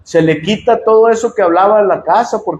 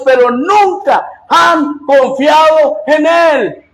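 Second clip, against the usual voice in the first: higher, impassioned pulpit delivery with shouted peaks; fiery preaching; 5 semitones higher than usual. Acted. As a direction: preach it hard to the congregation. Preaching rising to full force.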